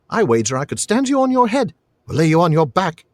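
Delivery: put-on high boy's voice, hopeful boast; high-pitched, eager challenge; hopeful lad. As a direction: dare him like a cocky boy. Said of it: "he added hopefully".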